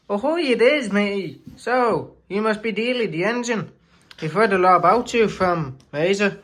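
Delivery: high, cheery pirate greeting. Acted. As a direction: hail a newcomer like a jolly sailor. Shed engine greets Dealey pirate-style.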